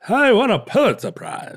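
a 1940s mid-Atlantic newsreel voice; mid-Atlantic accent. Pretend. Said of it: "like a mid-Atlantic accent".

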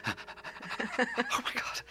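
very slow, high, breathless whisper of disbelief; awed whisper under the breath; stunned whisper. Retold or imitated. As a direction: whisper it to yourself, slow and amazed. Inner reaction to praise.

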